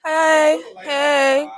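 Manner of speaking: calling out to the room; loud call. Real, not acted. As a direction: grab everyone's attention. Calling the set to attention.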